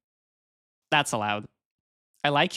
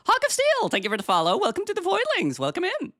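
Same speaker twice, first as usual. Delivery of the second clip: high cheery greeting; bubbly streamer welcome; cute anime streamer; 14 semitones higher than usual. Acted. Greeting follower.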